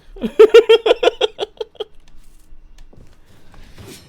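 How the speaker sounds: loud, high burst of laughter; real laughter at the chat; big laugh. Real, not acted. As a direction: really crack up. Streamer cracks up at chat.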